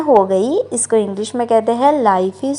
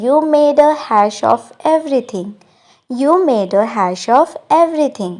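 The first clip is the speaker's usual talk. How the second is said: slow, clear English model line; slow model sentence; much slower than usual. Shown as a demonstration. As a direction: spell it out gently for learners. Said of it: "English में कहेंगे". (धीरे और साफ़ अंग्रेज़ी वाक्य)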